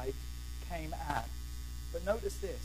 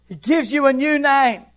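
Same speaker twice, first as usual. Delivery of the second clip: high, loud preacher's cry; loud proclamation; 6 semitones higher, 10 dB louder than usual. Real, not acted. Preacher to congregation on their new name, loud proclamation. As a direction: ring it out as the climax.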